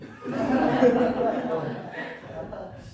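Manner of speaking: a real laugh that rises as it goes; burst of laughter. Real, not acted. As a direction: laugh out loud at your own joke. Laughs at own joke. (자기 농담에 터진 웃음)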